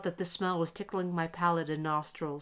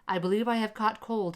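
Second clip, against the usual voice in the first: usual speaking voice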